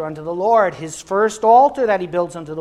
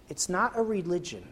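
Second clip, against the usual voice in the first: ordinary talk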